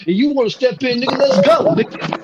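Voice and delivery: high, loud "let's go" challenge; challenging yell. Retold or imitated. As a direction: call him out to fight, voice cracking high. Challenging supervisor to fight.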